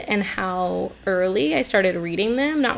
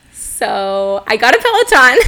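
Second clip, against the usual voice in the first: laughing out the news, loud; 4 semitones higher, 9 dB louder than usual. Real, not acted. Host laughing as she tells her co-host the news. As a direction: burst out laughing while telling it.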